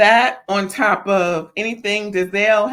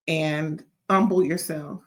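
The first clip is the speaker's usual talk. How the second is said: ordinary talk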